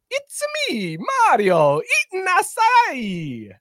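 high, slow cartoon plumber voice; high drawn-out mock Mario. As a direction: stretch it out like a silly Mario. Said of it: "All right, here we go".

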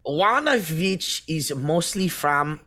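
put-on muddled French-German accent; French accent slipping German. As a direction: attempt French, drift into German. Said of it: "it's starting to sound like German".

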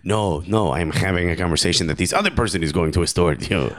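mock French accent slipping into Argentinian; comic French-Argentinian accent; Karim Benzema. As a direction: put on a wobbly foreign accent, French drifting Argentinian. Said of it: "I promise this is Karim Benzema".

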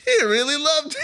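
can't believe he loved it; squealing with delighted surprise; amazed excitement. Real, not acted. Echoing the amazed reaction.

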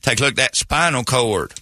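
hillbilly surgeon. Acted. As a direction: play a backwoods surgeon with a hillbilly accent. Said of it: "I've got a hillbilly about to cut me".

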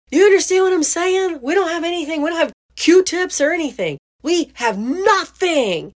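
very high, frantic mom insisting; high pleading disbelief, slowing at the end; high-pitched disbelief. Retold or imitated. Mom pressing the stepdad for a reaction, high-pitched disbelief. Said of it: "that high-pitched tone of disbelief".

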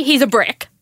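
ordinary talk, nothing marked

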